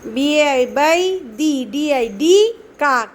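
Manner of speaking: slow, loud letter-by-letter spelling; slow phonic spelling. Shown as a demonstration. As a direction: sound out each letter for a child. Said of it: "ওইভাবে শেখাবে।". (ধীরে ধীরে অক্ষর ধরে বানান)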